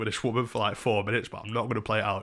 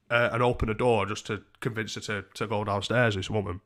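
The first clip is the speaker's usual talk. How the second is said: ordinary talk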